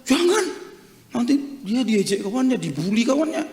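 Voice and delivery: acted objection, starting high and easing down; worried protest. Grandparent begging the pastor not to use the name, worried protest.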